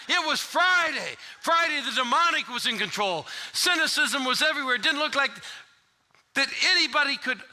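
high, loud preaching cadence; full-force preaching. Retold or imitated. Pastor preaching hard to the congregation. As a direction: preach it to the rafters. Builds the famous sermon.

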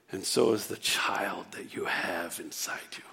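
whispered, awed blessing; reverent whisper. Acted. Elizabeth blessing Mary's unborn child, whispered in awe. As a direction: breathe it like a sacred secret.

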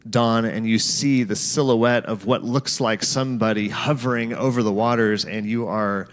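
guided scripture meditation. Acted.

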